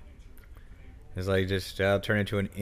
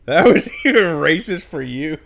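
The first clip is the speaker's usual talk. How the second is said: laughing quote; 7 semitones higher, 12 dB louder, slower than usual. Retold or imitated. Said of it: "you're like".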